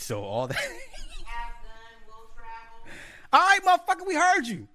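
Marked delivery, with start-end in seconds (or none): 0.6-3.3 high, quiet wheezing laugh; long giggling wheeze. Real, not acted. Husband cracks up teasing his wife, wheezy laugh. 3.3-4.7 very high, loud falsetto yell; mock exasperated shout. Husband shouts back at someone nearby, mock exasperation.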